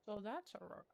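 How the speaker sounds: talking around a mouthful of chips; mouth full. Real, not acted. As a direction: say it while chewing. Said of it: "now I have to talk in my mouth".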